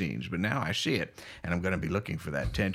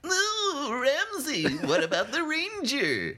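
very high, drawn-out cartoonish plea; mock dramatic wail; much slower than usual. Playing along in the bit with Ramsey, high mock wail. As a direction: wail it high and slow for laughs.

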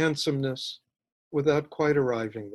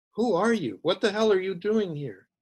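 higher, startled questioning; alarmed demand; 5 semitones higher than usual. A narrator's reading. Mother to a stranger in her baby's room, alarmed. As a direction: challenge the intruder, voice pitched up.

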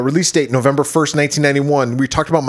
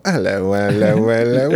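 lightly voiced Cockney hello; mock Cockney greeting; 3 semitones lower than usual. Mocking the actress, a light Cockney hello. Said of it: "She's fucking Cockney".

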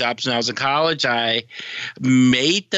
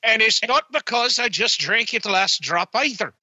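bad Irish accent; 5 semitones higher than usual. Co-host swears to Michael there's no whiskey, mock Irish accent. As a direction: swear innocence like a stage Irish drunk. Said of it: "I'm sorry, Irish accent".

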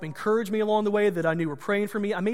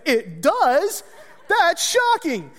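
hammed-up gasp, much higher and slow; mock surprise; 10 semitones higher, 7 dB louder, much slower than usual. Teasing the nurse about his weight loss, mock astonishment. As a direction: play innocent, overdo the shock.

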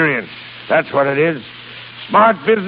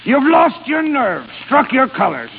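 high, indignant nautical insults; angry tirade; 6 semitones higher than usual. Acted. Boss berates Peasley for quitting, outraged bluster. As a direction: build a blustering tirade, hurling each insult.

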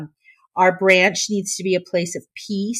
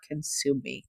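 choked up; 6 semitones lower, 12 dB quieter than usual. Real, not acted. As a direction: voice catching, fading softly into tears. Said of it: "now I'm crying".

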